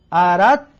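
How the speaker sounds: اردت with no d sound, a doubled t; dal merged into ta. Shown as a demonstration. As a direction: say اردت as "arattu" with the د swallowed into ت. (اردت را بدون دال، با ت مشدد بگو)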